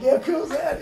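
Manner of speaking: high, laughing offhand voice; laughing mock reply. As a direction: toss it off through a laugh. Foo Fighters reacting, laughing.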